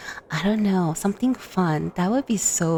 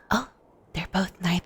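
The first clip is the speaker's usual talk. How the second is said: normal talking voice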